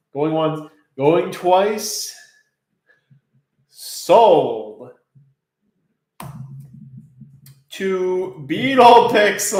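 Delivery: rising auctioneer call, sold!. Acted. Auctioneer calling the sale.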